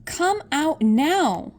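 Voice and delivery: high, loud and slow command; demanding shout. A narrator's reading. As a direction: call him out firmly. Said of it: "she shouted".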